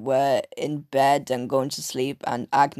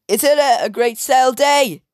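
Miss Hattie voice; 9 semitones higher, 5 dB louder than usual. Retold or imitated. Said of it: "And then said".

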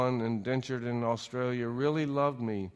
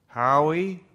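woman's voice; 5 semitones higher, 7 dB louder than usual. Acted. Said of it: "a woman's voice said".

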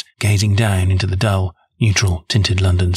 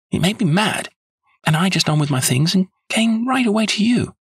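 high-pitched, aggrieved character voice; indignant client; 6 semitones higher than usual. A narrator's reading. Client telling the detective of her anger, high indignant voice. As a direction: complain in a raised pitch, mildly cross.

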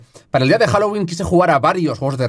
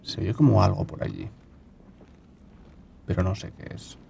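breathy much softer whisper; 7 semitones lower, 13 dB quieter than usual. Real, not acted. Hiding from the game's mic, whispering. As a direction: breathe the words to stay unheard.

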